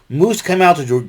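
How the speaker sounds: ordinary talk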